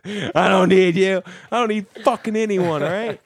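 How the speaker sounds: high, loud hammed-up outburst; mock defiant yell. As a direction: shout it like a defiant diva.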